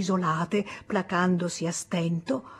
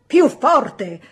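loud, raised order; teacher shouting; 5 semitones higher, 9 dB louder than usual. A narrator's reading. Teacher shouting at the mumbling boy to speak up. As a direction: shout it to be heard over the class. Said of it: "gridò l'insegnante".

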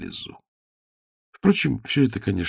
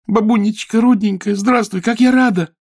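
high, loud happy cry; overjoyed; 13 semitones higher, 6 dB louder than usual. A narrator's reading. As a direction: burst out with joy. Girl hugs grandma joyfully.

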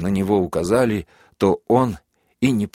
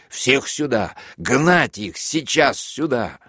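high, slow commanding yell; shouted order; 5 semitones higher, slower than usual. A narrator's reading. Major orders the prisoners brought out, shouting high. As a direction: bellow them all over here.

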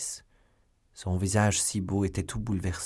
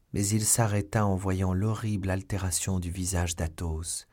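usual speaking voice

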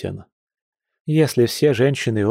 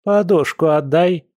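high, stern granny's command; strict order; 7 semitones higher, 3 dB louder than usual. A narrator's reading. Grandma orders him to give up the pillow, stern. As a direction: order it back sternly, pitch raised. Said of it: "ещё строже велела баба Эля".

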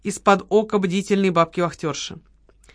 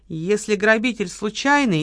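ordinary delivery

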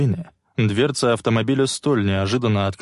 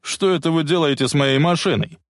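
higher, mildly indignant call; 6 semitones higher than usual. A narrator's reading. Musician challenges bullies at his car, calling out. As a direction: raise the pitch in protest. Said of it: "крикнул он".

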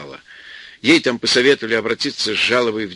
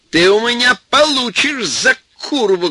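loud threat, much higher, voice quavering; offended old woman shouting, voice trembling; 12 semitones higher, 5 dB louder than usual. Acted. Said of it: "громко, с дрожью в голосе".

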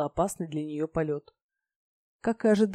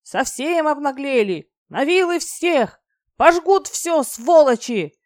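loud, high, drawn-out furious yell; angry shouted threats; 8 semitones higher, 9 dB louder, slower than usual. A narrator's reading. Fearless farmhand yelling at a dragon burning the field, furious shout. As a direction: bellow threats like an enraged field worker. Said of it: "гневно выкрикивая угрозы".

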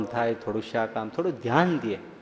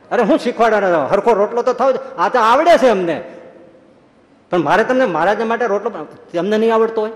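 loud, higher, indignant scolding; heated quarrel; 6 semitones higher, 6 dB louder than usual. Retold or imitated. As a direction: tell her off, she won't be taught. Gangama quarrelling with Jivba. (ગુસ્સાથી મોટેથી ઝઘડો)

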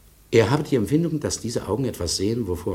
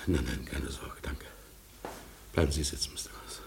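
breathless whisper; 5 semitones lower, 10 dB quieter than usual. Acted. Maso waves off Mister Cross's help in a breathless whisper. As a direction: whisper it, gasping, barely any voice.

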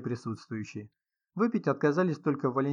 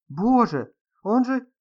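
high, loud horrified exclamation; gasped shock; 10 semitones higher, 8 dB louder, much slower than usual. A narrator's reading. Young woman sees the body, gasping in horror. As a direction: exclaim in shock, pitch leaping. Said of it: "ахнула молодая женщина".

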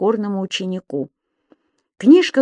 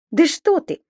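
lightly raised, lively protest; objecting; 8 semitones higher, 4 dB louder than usual. A narrator's reading. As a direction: contradict him eagerly. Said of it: "возразил Субастик".

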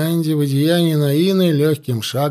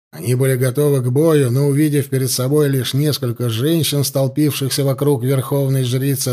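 usual speaking voice

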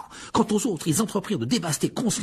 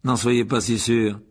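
annoyed; 3 semitones lower, 5 dB louder, slower than usual. A narrator's reading. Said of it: "d'un air agacé".

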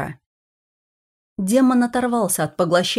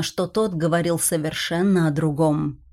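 usual speaking voice

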